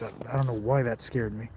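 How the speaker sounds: soft, low murmur to the camera; hushed. Real, not acted. Vlogger explaining a scare in the dark, hushed. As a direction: keep it down after a scare. Still hiding, just got scared.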